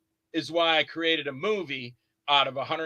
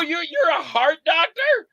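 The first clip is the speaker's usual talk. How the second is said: higher, slow stunned question; can't believe it, spell it out; disbelief; 11 semitones higher, 5 dB louder, slower than usual. Retold or imitated. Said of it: "I go".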